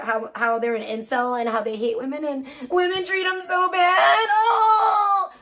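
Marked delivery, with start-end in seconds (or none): 2.7-5.3 high, whiny fake sobbing; exaggerated boo-hoo, drawn out and mocking; mock crying. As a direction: wail it like a hammed-up crybaby. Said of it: "cried to me in my D Ms".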